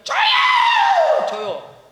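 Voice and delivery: high, loud, dragged-out "줘요!" that fades on the second; a child tugging at mom's pants, begging; whining child's demand. Retold or imitated. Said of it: "무조건 바지가랑 잡고 줄 때까지". (엄마 바지를 잡고 떼쓰는 아이, 높고 크게 길게 외치는 소리)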